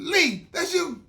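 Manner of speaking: high, loud, slow cry of surprise; surprised recognition. Retold or imitated. Old friend recognizing Lee in hospital, loud surprised cry. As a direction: stretch it out in shocked delight.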